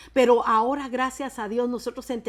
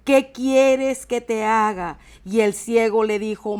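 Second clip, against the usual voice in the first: loud, emphatic Bible reading; forceful, measured preacher's reading; declaimed scripture reading; 2 semitones higher, 8 dB louder than usual. A narrator's reading. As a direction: proclaim each phrase to the congregation. Reads scripture aloud at force.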